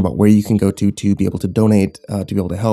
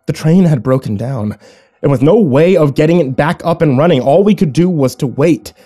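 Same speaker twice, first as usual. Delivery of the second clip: higher, animated storytelling; dramatic story narration; 6 semitones higher, 4 dB louder than usual. A narrator's reading. Narrator describing the train's sudden stop, dramatic narration. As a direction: narrate the sudden danger with urgency.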